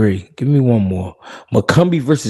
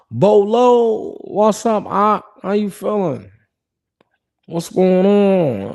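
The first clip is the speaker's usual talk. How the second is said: much higher, bright shout-out to a viewer; hyped greeting; 9 semitones higher, slower than usual. Real, not acted. Host greets his chat friend Bolo with a high, excited hello. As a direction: stretch the greeting out, slow and excited.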